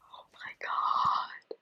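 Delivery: breathless stunned whisper; awed whisper. Real, not acted. Knitter stunned the paperclip holds stitches, whispering. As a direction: whisper it in awe.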